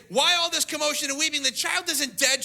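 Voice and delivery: high, emphatic questioning; challenging the mourners. Retold or imitated. Jesus to weeping mourners, emphatic challenge.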